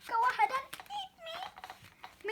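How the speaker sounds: high, slow, resigned sigh; resigned donut voice. Acted. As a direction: give up and offer yourself, high and slow. Arnie resigned, acted voice.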